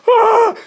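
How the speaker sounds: put-on short, very high, loud shriek of a startled boy; imitated kid scream. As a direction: yelp like the kid bolting.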